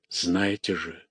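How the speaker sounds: plain reading voice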